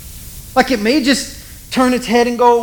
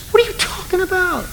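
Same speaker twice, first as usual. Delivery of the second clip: pitched-up what are you talking about; incredulous high retort; 10 semitones higher than usual. Retold or imitated. Man answering a nosy adviser, pitched-up disbelief. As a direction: bristle at the silly question.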